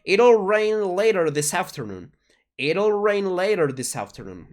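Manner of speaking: "It'll" with a tapped R, slow and clear; flapped t in a sentence. Shown as a demonstration. Teacher modeling the full sentence, slow and clear. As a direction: slowly model "It'll rain later this afternoon" with the flap. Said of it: "La T vamos a cambiarla por una R".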